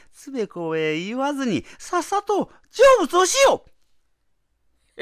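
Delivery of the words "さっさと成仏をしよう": high, loud jeering shout; taunting shout. Acted. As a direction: yell it mockingly. Wife taunts him to die.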